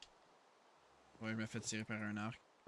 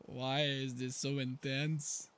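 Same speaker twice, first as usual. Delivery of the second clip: slow and tense; 4 dB louder, much slower than usual. Real, not acted. Tense moment in game.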